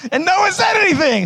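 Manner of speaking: high, comic outburst; indignant shout. Real, not acted. Comedian to silent audience, indignant shout. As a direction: shriek it in mock outrage. Berates audience for not telling him.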